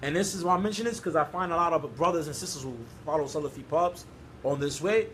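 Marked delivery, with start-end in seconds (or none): none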